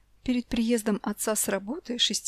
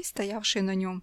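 ordinary delivery

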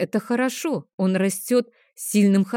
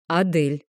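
usual speaking voice

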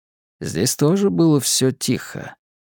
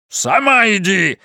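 exploding; 7 semitones higher, slower than usual. A narrator's reading. Monteyn snapping back at Anna, an angry outburst. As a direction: blow up and throw it back at her. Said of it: "взорвался Монтейн".

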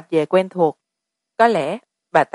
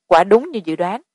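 ordinary delivery

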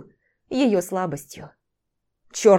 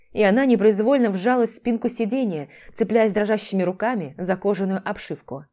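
plain reading voice

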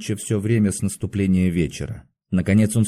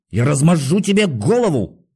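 furious high-pitched threat; hoarse shout; 5 semitones higher, 4 dB louder than usual. A narrator's reading. Patient threatens the guard behind the door, shouting hoarsely. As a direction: rage at your captors with a strained cry. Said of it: "крикнул он сиплым голосом".

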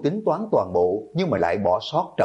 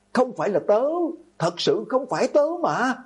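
high-pitched frightened protest; screamed denial. A narrator's reading. Accused girl backing away from her attacker, screamed denial. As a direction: cry out innocence in a thin high pitch, without much volume. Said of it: "Từ Nhược Băng vừa hét lên".

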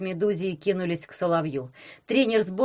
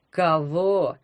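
sarcastic; 2 semitones lower, 3 dB louder than usual. A narrator's reading. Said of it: "язвительно воскликнул О-разбойник".

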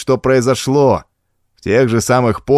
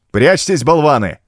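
higher, urgent mild shout; 5 semitones higher than usual. A narrator's reading. Villiers orders his men to hide, shouting. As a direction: yell a scornful order to take cover. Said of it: "выкрикнул Вильер".

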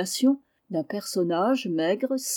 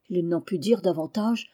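usual speaking voice

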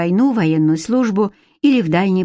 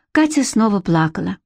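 ordinary delivery

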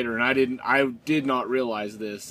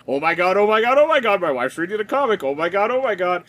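inner excited panic; 6 semitones higher, 6 dB louder than usual. Retold or imitated. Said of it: "in my head I was like".

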